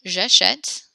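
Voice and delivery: loud model word. Shown as a demonstration. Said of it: "So this is how we pronounce them".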